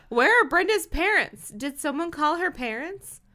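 high, urgent questions; anxious calling out. Acted. Onlooker asks crowd about Brenda's parents, anxious calling out. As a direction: start loud and worried.

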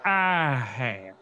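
low, drawn-out ominous confession; Lindy. A narrator's reading. Sister confessing to Chris, low ominous voice. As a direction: let it fall low and sinister. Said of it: "Lindy said".

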